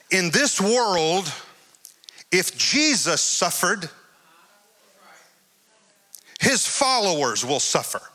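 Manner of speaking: each phrase drawn out slowly and deliberately; slow weighty emphasis. Real, not acted. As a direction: lay it down slowly, not shouting. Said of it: "this ain't the shouting part right here".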